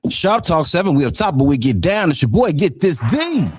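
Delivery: high, pumped DJ announcer call; radio host hype intro. Acted.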